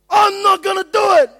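high, loud, drawn-out mock wail; shouting like a bawling toddler refusing; toddler tantrum. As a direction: throw a hammed-up toddler tantrum. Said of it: "You're like a toddler".

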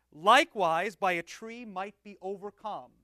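slow, measured liturgical reading; slow and prayerful. Shown as a demonstration. Finishing the preface for the class, slow and prayerful. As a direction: let each word land reverently. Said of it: "I'll read it slowly and prayerfully".

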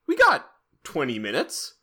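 high, fast, goofy mock voice. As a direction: squeak out a silly studio pitch. Mocking filmmakers' voice.

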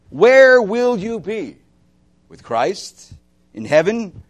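preacher confronting the congregation, loud and slow; urgent challenge. Acted. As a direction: press the question hard on them.

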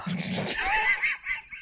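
high screaming burst of laughter; shrieking laugh. Real, not acted. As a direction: let out a wild shriek of laughter. Bursts out at hackerized word.

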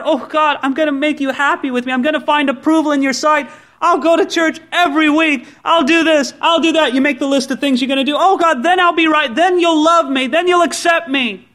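a legalist pleading with God to love him; eager striver. Acted. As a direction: beg God for approval, eager and insistent. Pleading for God's approval.

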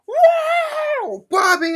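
loud, very high shouted greeting; loud drunk greeting. Retold or imitated. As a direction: yell it across the room like a rowdy drunk. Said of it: "'cause he was loud".

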